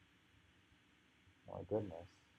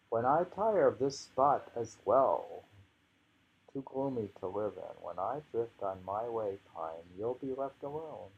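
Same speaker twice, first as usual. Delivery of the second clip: louder opening line of recited verse; louder poetry recital; poem reading; 3 semitones higher, 6 dB louder than usual. Acted. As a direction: read the verse again with a lifted start. Rereading the poem.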